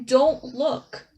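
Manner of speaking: mild stage scream; acted scream. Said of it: "she screams at him".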